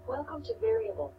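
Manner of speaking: ordinary talk, nothing marked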